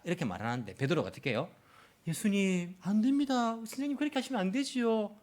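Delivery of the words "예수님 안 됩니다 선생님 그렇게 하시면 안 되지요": polite pleading. Shown as a demonstration. Disciple mildly asking his teacher not to, polite pleading. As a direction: object meekly, without any force. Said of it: "이렇게 말한 것이 아니라".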